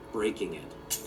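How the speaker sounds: plain reading voice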